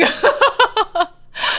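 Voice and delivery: high, loud peal of laughter; helpless laugh. Real, not acted. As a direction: crack up completely, high and loud. Cracks up at a chat comment.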